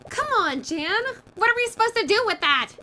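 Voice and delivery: high, loud frustrated protest; exasperated outburst. Acted. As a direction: throw up your hands in disbelief. Game character protests loudly.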